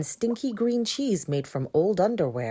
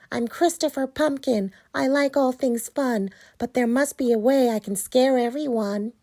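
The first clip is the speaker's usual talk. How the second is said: pumpkin voice; 7 semitones higher, 4 dB louder than usual. Acted. Chris worried.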